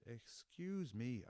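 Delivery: indignant slowed-down echo of her; mocking excuse me. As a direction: drag it out to throw her words back. Speaker mocks her back.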